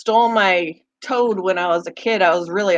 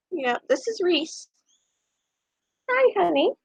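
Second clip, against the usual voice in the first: much higher cooing voice; 7 semitones higher than usual. Real, not acted. Owner cooing to her guinea pig in baby talk. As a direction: coo over the little pet.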